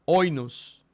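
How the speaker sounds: oinos with no breath before it; smooth breathing oinos. Shown as a demonstration. Teacher modelling an unaspirated word for students. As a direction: start oinos clean, no aspiration. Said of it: "es un espíritu suave".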